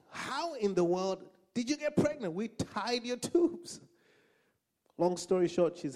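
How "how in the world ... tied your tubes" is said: high, drawn-out incredulous outburst; doctors going crazy. Retold or imitated. Doctors to a patient pregnant after surgery, frantic disbelief. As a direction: exclaim high, stretching it in disbelief. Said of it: "the doctors were going crazy".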